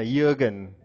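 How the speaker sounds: Jürgen with a y sound at the start; German pronunciation. Shown as a demonstration. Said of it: "That's a German way of pronouncing it".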